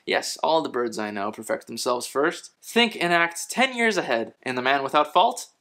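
usual speaking voice

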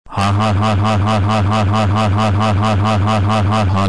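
mechanical, evenly repeated "ha ha ha"; robotic, monotone cackle; mock villain laugh. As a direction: chant out a long fake laugh on one pitch.

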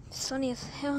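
ordinary talk

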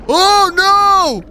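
high, loud startled yelp; sudden shock at a threat; alarmed cry. Real, not acted. Gamer startled by a shark, loud high yelp.

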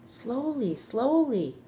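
high, gliding, drawn-out warning; cautioning call. A narrator's reading. Charlotte checking Wilbur as he races out, singsong caution. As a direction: rein someone in with a singsong caution.

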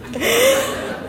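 short, high, breathy burst of laughter; relieved high laugh. Real, not acted.

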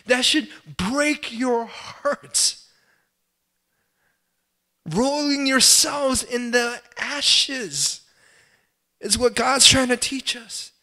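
high, loud, drawn-out anguished preaching; preaching at full force. Real, not acted. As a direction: cry it out to the congregation, slow and heavy.

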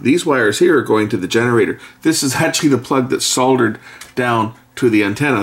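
ordinary talk

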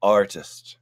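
a hint of a gentle mystical Yeti; kindly voice of the Yeti. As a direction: speak slowly and kindly as a wise Yeti. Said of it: "said the kindly voice of the mystical Yeti".

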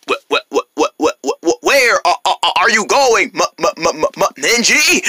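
stuttering robotic pig. Acted. Zombie pig stalking Minji, robotic stutter. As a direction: repeat syllables like a stuck machine. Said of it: "acting like a robot".

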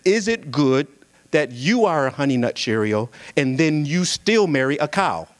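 normal talking voice

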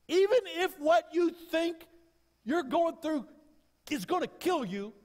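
high, loud climactic preaching cry; full-throated revival preaching; preaching at full force. Acted. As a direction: lift it to a soaring shout from the pulpit.

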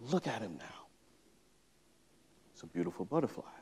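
put-on hushed, soft reveal to a child; quiet bedtime-story voice; tender storybook reading. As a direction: softly marvel at it, pausing midway. Reading softly to his daughter.

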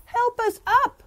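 high, loud plea; crying out. Acted. Little letter A calling out for help. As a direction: call up for help. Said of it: "cried A".

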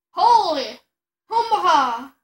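loud shocked outburst; shocked shout. Real, not acted. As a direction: blurt it out stunned.

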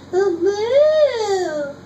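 loud, high mooing cow. Acted. Reading to little sister, a loud high cow moo. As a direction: belt it out like a cow. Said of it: "He can sound like a cow".

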